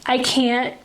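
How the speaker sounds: choked up, words stalling; real tears. Real, not acted. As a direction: break off as the tears come. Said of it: "I didn't think I would cry".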